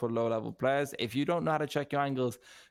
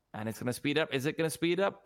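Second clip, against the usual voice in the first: ordinary talk, nothing marked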